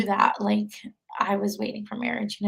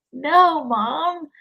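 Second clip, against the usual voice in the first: retold protest, higher and louder at first; protesting daughter; 6 semitones higher, 4 dB louder than usual. Daughter refusing her mom's advice to convert, protesting. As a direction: push back at your mother in a retelling.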